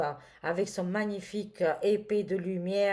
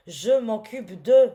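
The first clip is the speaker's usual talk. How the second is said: solemn angel voice; 2 semitones higher, 9 dB louder than usual. Pretend. Channels Archangel Michael's message.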